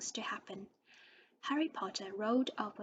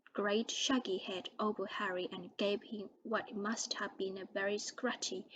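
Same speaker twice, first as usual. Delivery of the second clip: ordinary delivery, nothing marked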